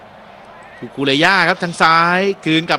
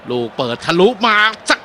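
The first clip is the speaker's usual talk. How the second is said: higher, rising live match call; excited play-by-play; 7 semitones higher, 4 dB louder than usual. Acted. Commentator calls a cross cleared for viewers, excited. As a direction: ride the attack as it happens.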